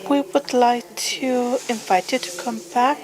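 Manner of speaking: normal talking voice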